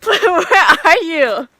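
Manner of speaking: laughing while calling out. Real, not acted. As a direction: call out through laughter.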